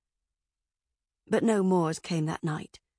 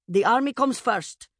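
loud, firm pronouncement; commanding ruling; 4 semitones higher, 5 dB louder than usual. A narrator's reading. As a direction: rule it with authority.

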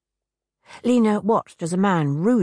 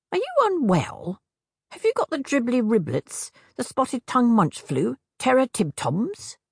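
higher, quirky hag voice; 6 semitones higher than usual. A narrator's reading. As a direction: play the fussing old hag.

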